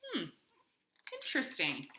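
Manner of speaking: usual speaking voice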